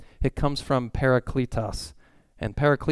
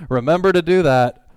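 high, loud exasperated reminder; nagging parent; 4 semitones higher, 9 dB louder than usual. Retold or imitated. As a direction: nag the kids loudly. Parent nagging kids to remember.

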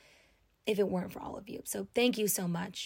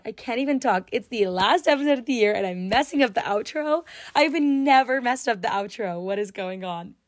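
flustered laughing; 3 semitones higher, 8 dB louder than usual. Real, not acted. Host fumbling the final outro, flustered and laughing. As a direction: laugh through the stumble. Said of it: "I literally cannot talk".

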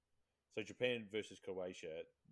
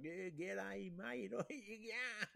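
hammed-up ocker Aussie, much higher; mock Aussie; 12 semitones higher, much slower than usual. Mocking new Aussie fans, hammed-up high ocker Aussie accent. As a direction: play a cheesy bandwagon Aussie fan, laughing.

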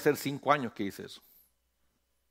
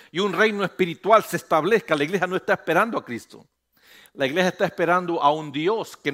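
loud, rising pulpit declaration; fervent preaching; 3 semitones higher, 6 dB louder than usual. Acted. Preacher proclaiming the second coming to the congregation, fervent and loud. Rebuking the church.

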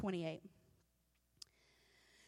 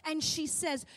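ordinary talk, nothing marked

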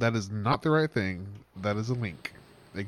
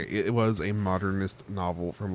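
normal talking voice

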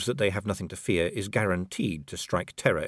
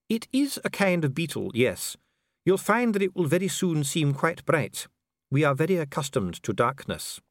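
higher, smooth and coolly polite; a bureaucrat soothing a prisoner; calm official Dee; 9 semitones higher than usual. A narrator's reading. Dee answering imprisoned Vimes, coolly polite.

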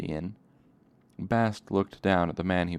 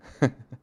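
short laugh; 7 semitones higher, 6 dB louder than usual. Real, not acted. Reader laughs at own joke.

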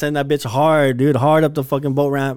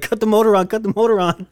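higher, louder mock yell to cut the motor; urgent yell; 9 semitones higher, 5 dB louder than usual. Retelling the uncle's order on the boat, urgent mock yell. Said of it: "my buddy's uncle's like".

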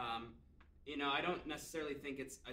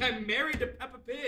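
laughing; 6 semitones higher than usual. Pretend. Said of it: "I was like".

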